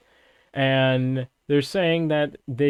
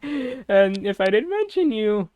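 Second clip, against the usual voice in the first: higher, slower, put-on voice; mock dramatic voice; 9 semitones higher, 3 dB louder, slower than usual. Shown as a demonstration. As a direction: stretch it out in a silly put-on voice.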